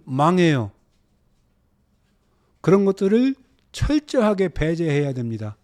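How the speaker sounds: loud, high warning from the pulpit; emphatic preaching. Real, not acted. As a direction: hammer each item home. Warns the class.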